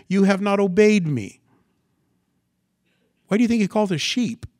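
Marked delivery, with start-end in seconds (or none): 0.1-1.4 loud, higher, emphatic reprimand; God's rebuke. Acted. God replying to the believer's question, loud emphatic rebuke. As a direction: drive the answer home, raised and firm. Voicing God's answer again.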